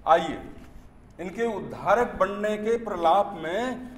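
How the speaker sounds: poem recitation. Acted. Poet reciting satire on Dalit sympathy to an audience, declaimed recitation. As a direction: declaim it in measured phrases, lifting the line ends.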